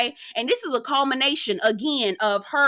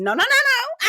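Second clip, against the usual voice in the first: high, laughing no-no-no; mock Mel; 13 semitones higher than usual. Mel refusing to take off her shades, high and laughing. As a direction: squeal it like Mel dodging. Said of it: "She go reaching for her face".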